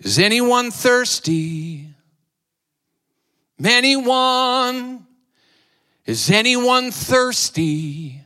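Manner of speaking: slow, high appeal; calling out. Acted. Preacher calls out to the thirsty congregation. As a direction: plead with the crowd to answer.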